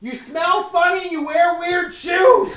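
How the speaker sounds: high, slow shouted taunt; mock shouted insult. Taunting an imagined annoying stranger, mock shout. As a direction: sneer it out loud and slow.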